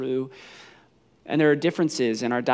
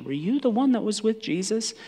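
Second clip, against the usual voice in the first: light, higher-pitched casual question; mock-casual question; 8 semitones higher than usual. Servant girl casually questioning Peter, higher and light. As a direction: ask it as if just curious. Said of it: "not just casually asking for information".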